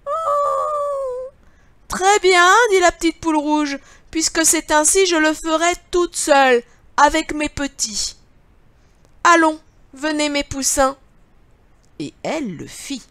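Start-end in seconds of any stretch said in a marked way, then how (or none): 0.1-11.0 high, loud hen voice; little red hen. Acted. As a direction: cluck it out, high and bright.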